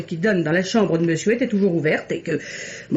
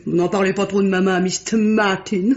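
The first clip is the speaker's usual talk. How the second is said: normal talking voice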